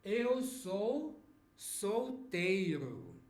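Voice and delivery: solteiro drawn out very slowly; slow, careful teacher model of eu sou solteiro; slow model phrase. Shown as a demonstration. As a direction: spell it out slowly for learners.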